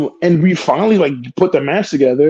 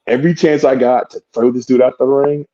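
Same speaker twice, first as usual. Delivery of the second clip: ordinary talk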